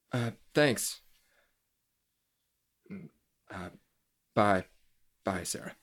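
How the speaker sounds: low, soft, hesitant sign-off; subdued goodbye. Acted. Drew ending a phone call with Sarah, subdued. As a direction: trail into a low, halting goodbye.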